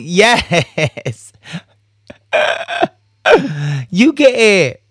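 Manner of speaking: high giggly laugh into the words. Real, not acted. Laughing after cheeky approval.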